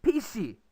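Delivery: "pishi" for fish, P for F plus a final ee; Konglish pronunciation. Shown as a demonstration. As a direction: say "pishi" in two syllables, Korean-style. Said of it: "Fish is actually usually said by Korean as".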